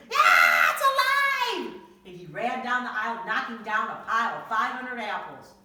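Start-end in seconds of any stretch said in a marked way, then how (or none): 0.1-1.8 very loud, high, slow shriek of horror; terrified scream. Acted. Man fleeing the living doll, horrified shriek. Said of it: "The man screamed".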